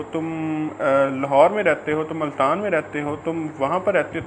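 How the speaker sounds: ordinary delivery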